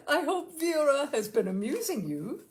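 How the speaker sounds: fussy aunt breezing into the room; bustling aunt. A narrator's reading.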